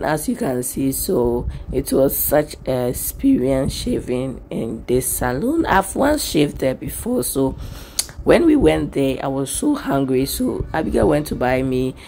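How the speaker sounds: normal talking voice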